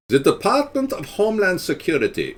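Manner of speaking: put-on high, heavy German-accented Department; a hint of a German accent; mock German. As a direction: bark it like a German officer.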